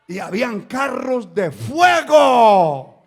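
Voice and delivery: loud cry, stretched at the end; preacher's proclamation. Real, not acted. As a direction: proclaim the wonder with a drawn-out shout.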